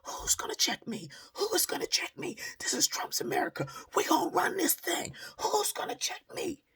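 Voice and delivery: high, loud imitation of her screaming; mock screaming woman. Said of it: "yelling and screaming".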